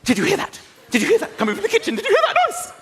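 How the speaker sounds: rising, anxious mock wife; a touch of a frightened wife; wife's panicked whisper-shout. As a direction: play the jumpy wife hearing a noise. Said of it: "She's like".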